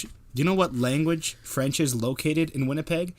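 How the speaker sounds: usual speaking voice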